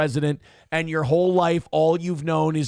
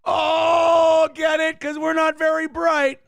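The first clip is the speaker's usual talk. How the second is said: high, loud sarcastic outburst; loud sneering gotcha; mocking shout; 11 semitones higher, 5 dB louder, slower than usual. Real, not acted. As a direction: yell it back in mock disbelief. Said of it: "mocking the insult, shouting".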